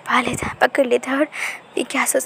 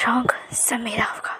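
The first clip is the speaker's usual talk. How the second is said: possessive whisper; 5 dB quieter than usual. A narrator's reading. Wing whispers his claim.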